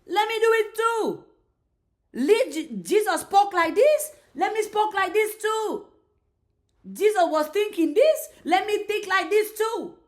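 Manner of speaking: keen imitator voice, rising high; eager follower. Speaker acting someone who emulates Jesus, eager and determined. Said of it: "you say".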